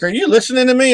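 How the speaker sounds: high, loud nagging wife; imitating the wife. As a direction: mimic his wife scolding him. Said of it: "she's like".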